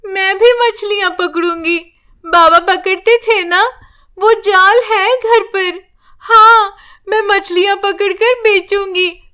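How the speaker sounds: put-on a small girl talking herself into a brave plan; determined little girl. A narrator's reading. Granddaughter plans to sell fish for grandma's care, childlike resolve.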